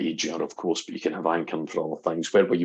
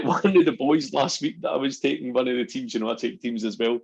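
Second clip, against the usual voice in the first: usual speaking voice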